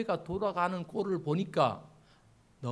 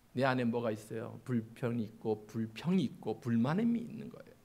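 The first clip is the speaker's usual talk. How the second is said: ordinary delivery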